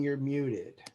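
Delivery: usual speaking voice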